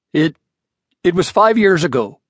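hurried stammer of a man caught in a fib. A narrator's reading. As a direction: blurt it out, caught out. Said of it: "said Mister Breed hurriedly".

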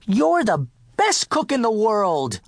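high, eager dog's exclamation; barked praise. A narrator's reading. Dog encouraging the downhearted cook, barked praise. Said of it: "I barked".

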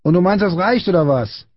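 put-on high indignant boy's call. A narrator's reading. Small boy challenging Friedrich's apology, indignant call.

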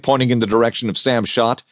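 ordinary delivery, nothing marked

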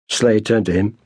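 ordinary delivery, nothing marked

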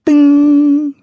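high slow bell-like ding. A narrator's reading. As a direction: sing it out like a bell. Imitating the bell sound.

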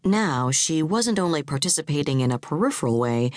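plain reading voice

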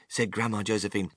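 ordinary delivery

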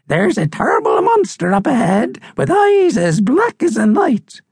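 high, dramatic bird voice; old crusty seabird, ominous. Acted. Seabird describes the monster to frighten visitors, high and ominous. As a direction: build dread in a high crone-like bird voice.

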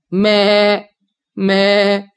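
lightly voiced goat; goat bleat. Goat bleats after answering.

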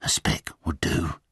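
low, whispered coaxing; conspiratorial whisper. Acted. Slaughterer bargaining with Twig, conspiratorial whisper. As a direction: whisper it as a secret deal. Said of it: "in a conspiratorial whisper".

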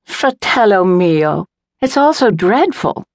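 high-pitched, dramatic wail of sympathy; effusive, theatrical condolence in a high voice; squawking lament. A narrator's reading. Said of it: "like some mad bird with a squawk".

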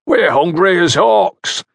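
high, drawn-out hungry shout; shouted taunt. A narrator's reading. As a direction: holler the complaint slowly across the field. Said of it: "shouted one of them".

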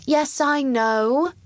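put-on high, drawn-out impatient boy; interrupting. A narrator's reading. Young king interrupting the Chancellor, impatient and high. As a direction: cut in with a long, high "I know". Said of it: "Lionel interrupted".

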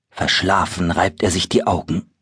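ordinary delivery

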